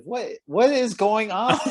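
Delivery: a high, slow, stunned "what is going on"; mock disbelief at a strange scene; baffled disbelief. Friend retells being baffled by the bank scene, stunned and high. Retelling own confusion at movie.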